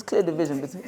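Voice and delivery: words dissolve into a high laugh; cracking up at the Android joke; breaking into laughter. Real, not acted. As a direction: crack up mid-sentence at your own joke.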